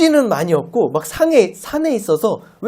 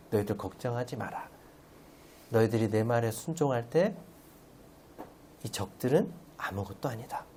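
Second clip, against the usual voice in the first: God reassuring; 7 semitones lower, 13 dB quieter than usual. Acted. Voicing God's reassurance softly.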